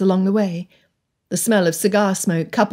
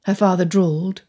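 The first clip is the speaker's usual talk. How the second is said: ordinary delivery, nothing marked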